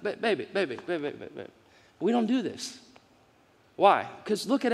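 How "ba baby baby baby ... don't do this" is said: put-on soft, fading baby baby, gentle Spirit; lightly voiced gentle Spirit calming a child; tender coaxing. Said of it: "The Holy Spirit says".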